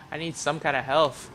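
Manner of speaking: ordinary delivery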